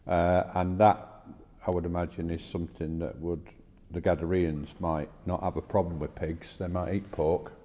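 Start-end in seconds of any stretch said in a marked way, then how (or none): none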